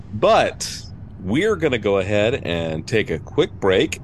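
ordinary talk

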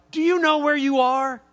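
stranger's high, loud aggressive challenge; aggressive confrontation. Retold or imitated. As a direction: get in his face and demand it. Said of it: "real aggressively".

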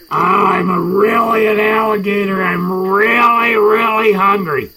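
alligator voice. Pretend. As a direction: boom it out like a hungry alligator. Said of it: "You mean like this?".

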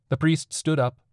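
ordinary delivery

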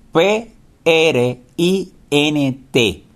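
slow, letter-by-letter dictation; spelling letter by letter. Shown as a demonstration. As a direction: spell each letter out slowly. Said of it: "la función print". (deletreando despacio, letra por letra)

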